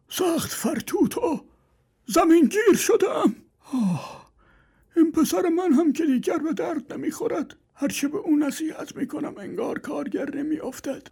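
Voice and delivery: put-on high, thin old man groaning and complaining; old father laments his lazy son, high and weak; frail old man. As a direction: play a worn-out old father sighing.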